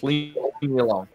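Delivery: lightly voiced spirit, pushed down and hushed; imitating the demon. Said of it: "You can hear it. It goes".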